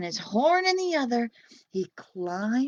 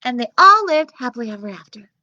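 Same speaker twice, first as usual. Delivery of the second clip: rushed ending; 10 dB louder, faster than usual. Acted. Narrator rushing the ending.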